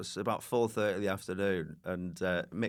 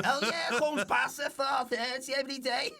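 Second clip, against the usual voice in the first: high, laughing mock Scouser; lightly hammed Liverpool sound man; Liverpool producer voice.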